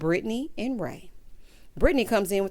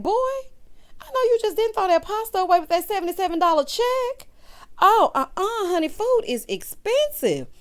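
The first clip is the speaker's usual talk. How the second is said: high, worked-up telling-off; mock scolding; 11 semitones higher, 3 dB louder than usual. Host telling off boyfriend over tossed pasta, mock scolding. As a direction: scold him in disbelief, pitched way up.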